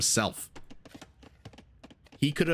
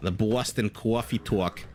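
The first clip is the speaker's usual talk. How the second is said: slow put-on East Coast accent, "coffee talk" as cwoffee twalk; stretched, rounded East Coast accent vowels on "coffee talk"; slower than usual. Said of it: "reference to Saturday Night Live".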